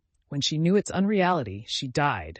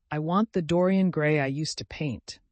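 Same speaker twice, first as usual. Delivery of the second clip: usual speaking voice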